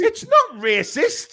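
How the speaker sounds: high, drawn-out, loud protest; indignant protest. Real, not acted. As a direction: protest it loudly, stretched out and high-pitched. Heated argument over doing an accent.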